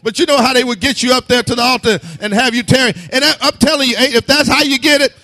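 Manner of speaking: loud, high, driving sermon; fervent preaching. Acted. Preacher urging the church, loud and fervent. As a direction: push them to get it.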